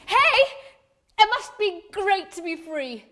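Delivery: high, loud hail to a stranger; excited activist accosting someone; calling out. Acted. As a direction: call out with eager, patronising zeal. Woman calls out to stranger.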